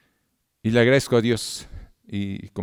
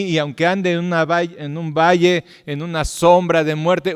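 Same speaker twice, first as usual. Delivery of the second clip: high, loud fervent preaching; 6 semitones higher, 4 dB louder than usual. Acted. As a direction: preach it out to the whole church with full force. Preaching, acted prayer.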